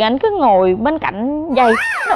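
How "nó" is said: ordinary talk, nothing marked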